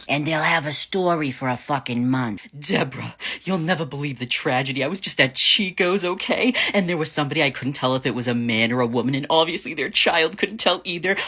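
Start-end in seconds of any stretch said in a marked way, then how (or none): 2.6-11.1 hammed-up gossip, pitched up and urgent; scandalized society lady. Upper-class woman telling Deborah the shocking tale, mock-scandalized gossip. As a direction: spill it like a horrified rich shopper.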